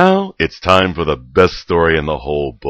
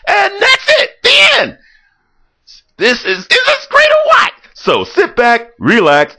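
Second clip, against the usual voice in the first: overexcited shout; 9 dB louder than usual. Real, not acted. Narrator gloating over his story.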